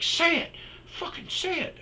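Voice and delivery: very high, loud imitation of a furious ex; re-enacted screechy taunt; mock angry goading. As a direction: shriek the demand as an imitated ex. Ex goading him in argument.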